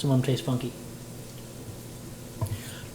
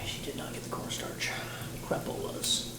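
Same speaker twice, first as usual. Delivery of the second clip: whisper; 13 dB quieter than usual. Real, not acted. Muttering to herself about forgotten cornstarch.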